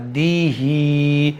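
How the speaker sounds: इबादिही with the ही drawn out one alif, दि short; slow recitation, holding only the final ही; Quran recitation demo. Shown as a demonstration. Teacher reciting slowly so students hear the long ही. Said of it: "ध्यान से सुनिएगा".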